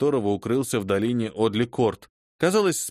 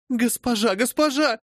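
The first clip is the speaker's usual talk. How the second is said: terrified cry; 12 semitones higher than usual. Acted. Servant girl crying out to her mistress in terror. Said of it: "закричала Феба".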